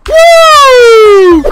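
loud, high whoop of triumph; excited whoop. Real, not acted. Gamer whoops loudly after a good shot. Celebrating a kill.

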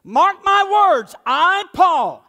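booming, deliberate warning; emphatic. Retold or imitated. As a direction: proclaim it like an apostle. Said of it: "he's being emphatic".

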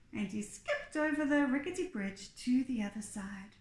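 ordinary delivery

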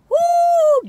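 high, loud woo; whoop. Real, not acted. Reacting to her own story, a loud high whoop. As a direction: let out a big whoop. Exclamation before "doggies.

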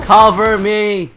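loud, slow call for cover; cover me shout. Real, not acted. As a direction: shout it slowly across the map. Calling to teammate mid-fight.

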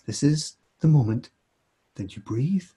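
hammed-up calming therapist; mock therapist voice. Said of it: "that was my mock therapist voice".

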